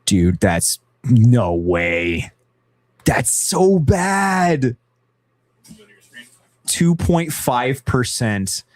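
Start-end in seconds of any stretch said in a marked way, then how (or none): 3.0-4.8 so stretched high and loud; stress on so. Real, not acted. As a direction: lean on "so" in "That's so bad". Reacting to statistic.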